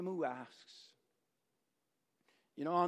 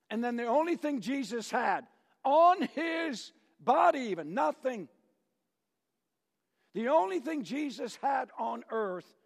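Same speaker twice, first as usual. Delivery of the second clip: higher and louder, swelling; rising preaching climax; 8 semitones higher, 5 dB louder than usual. Acted. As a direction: build it up like a preacher at his peak.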